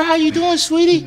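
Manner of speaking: way up high, sugary; high sweet nurse voice. Retold or imitated. Teller imitating the nurse greeting him, high and sugary. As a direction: croon it like a nurse.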